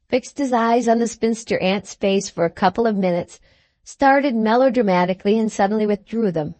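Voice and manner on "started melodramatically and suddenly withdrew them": ordinary delivery, nothing marked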